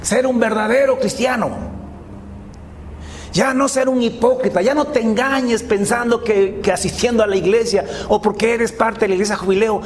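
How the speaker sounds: impassioned preaching. Real, not acted. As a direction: press the congregation to commit, pitch held high. Preacher exhorts the congregation. (prédica exhortativa, en tono agudo e insistente)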